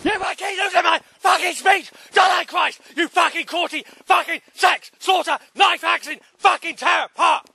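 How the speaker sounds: high, raging yell, swearing at full force; real loss of temper, shouting at the sky; furious shout. Real, not acted. Man yelling at God after losing his train of thought, furious shout. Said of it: "I'm sorry I'm losing my temper".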